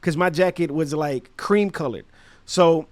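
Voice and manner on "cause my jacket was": plain reading voice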